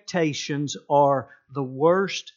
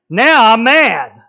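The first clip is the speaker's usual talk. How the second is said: mock mad; 6 semitones higher, 14 dB louder, slower than usual. Husband acting anger.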